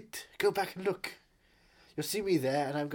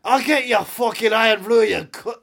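loud, high, ranting Scottish accent; 7 semitones higher, 11 dB louder than usual. Shown as a demonstration. Proving his Scottish to a sceptic, shouted Scottish accent. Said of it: "that's more Scottish".

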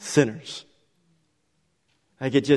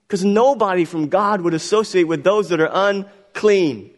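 high, loud, driving sermon delivery; impassioned preaching; 7 semitones higher, 5 dB louder than usual. Acted. Preacher to congregation on the outraged Pharisees, impassioned. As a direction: build to a raised, urgent preacher's cry.

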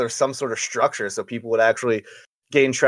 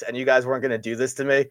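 ordinary talk

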